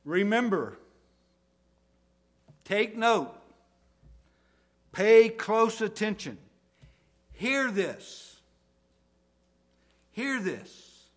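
high, loud calls for attention; emphatic urging. Acted. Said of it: "what Jesus is saying".

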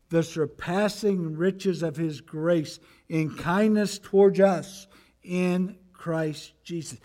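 a deliberate pulpit reading; scripture reading. Acted. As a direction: read it out slowly and solemnly. Reading the verse aloud.

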